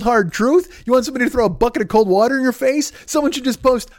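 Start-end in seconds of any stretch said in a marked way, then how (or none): none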